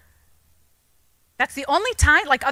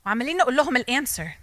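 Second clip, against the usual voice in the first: ordinary talk, nothing marked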